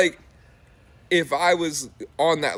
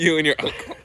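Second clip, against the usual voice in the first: laughing; 12 semitones higher, faster than usual. Real, not acted. Laughing repeat of a joke.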